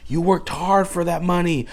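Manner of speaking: ordinary talk, nothing marked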